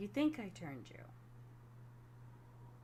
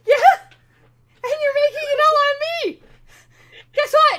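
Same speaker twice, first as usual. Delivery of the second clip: much higher and louder, fast playful yell; laughing shout; 20 dB louder than usual. Real, not acted. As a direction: yell it back while laughing at the table.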